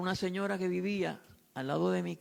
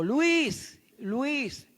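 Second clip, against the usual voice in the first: put-on high, quavering call of an old woman; eerie high voice calling Luis; trembling woman's voice; 7 semitones higher, 5 dB louder than usual. A narrator's reading. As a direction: call his name like a frightened ghost. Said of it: "una voz temblorosa".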